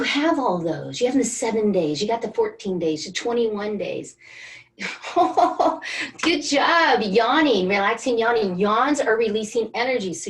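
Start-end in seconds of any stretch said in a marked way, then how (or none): 4.2-6.2 a real burst of laughter; bright laugh. Real, not acted. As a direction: laugh out loud, higher. Laughs at her own list.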